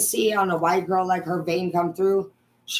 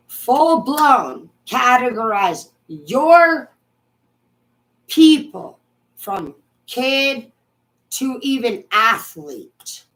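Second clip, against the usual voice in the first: angry rant; 5 semitones higher, 5 dB louder, slower than usual. Real, not acted. Ranting at Obama.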